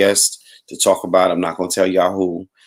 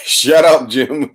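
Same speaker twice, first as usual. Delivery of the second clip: laughing shout; 7 semitones higher, 6 dB louder, much slower than usual. Real, not acted. Laughing at a viewer's comment.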